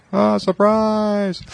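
high, slow drawn-out surprise cry; surprise shout. Retold or imitated. Crowd greeting birthday guest with a stretched, high surprise cry. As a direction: yell it as the crowd jumps out.

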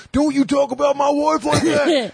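very high, strained imitation of an angry husband; mock outrage. Husband defending his wife to a prank caller, mock outrage.

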